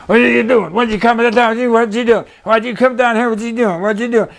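hard-to-understand, high-pitched repetitive chatter; Big Man. Retold or imitated. Prize fighter questioning a newcomer down there, high garbled repetition. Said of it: "you couldn't understand him. He'd say everything two or three times".

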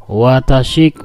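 ordinary delivery

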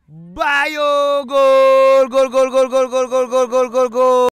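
screaming goal call, high and loud. Real, not acted. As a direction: yell the goal with everything.

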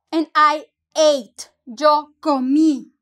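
slow and loud, stressing the final í of comí; slow teaching demo. Shown as a demonstration. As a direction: spell it out slowly for the class. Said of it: "con acento".

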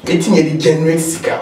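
ordinary talk, nothing marked

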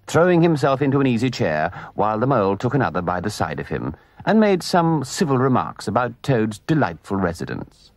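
ordinary delivery, nothing marked

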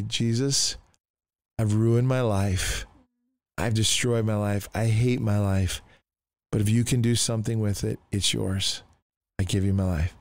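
subdued, sincere retold prayer; simple prayer. Kneeling alone before Jesus at four a.m., humbly praying. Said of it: "That was my simple prayer".

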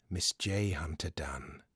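usual speaking voice

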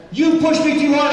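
high, loud ranting quit speech; angry mock outburst. Mayor storming out, shouting she quits. Mayor voiced quitting in anger.

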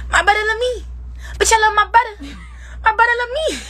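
put-on high squeaky baby talk, hoarse. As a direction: coo it like a little kid. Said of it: "do my baby voice".

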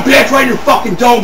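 ordinary talk

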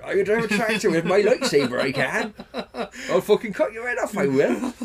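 loud, high-pitched West Country farmer villain; hammed-up West Country mimicry; David Prowse. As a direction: belt out comic threats in a West Country burr. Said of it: "that's what he sounded like".

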